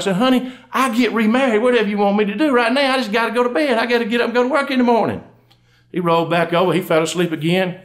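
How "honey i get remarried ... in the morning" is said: higher, pleading husband voice; hammed-up exasperation. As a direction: plead to be left to sleep, pitched high. Sleepy husband pestered by wife at night.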